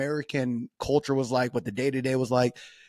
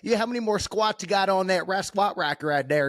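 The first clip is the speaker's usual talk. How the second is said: put-on Texan accent; 4 semitones higher than usual. Texan gym-goer asking about squats, mock Texan accent. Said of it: "the Texan accent".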